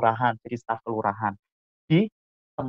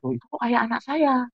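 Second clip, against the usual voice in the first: high-pitched puzzled question, imitating father; father's mild surprise; 11 semitones higher than usual. Father spotting his child at the show, mock surprise. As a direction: voice a dad doing a mild double take, pitched high.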